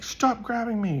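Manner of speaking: high-pitched mock complaint; imitated protest. Retelling the girl whose wrist was grabbed, high indignant protest. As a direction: pitch it way up as her objection.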